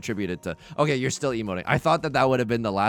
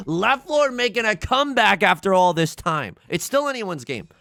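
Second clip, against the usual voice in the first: usual speaking voice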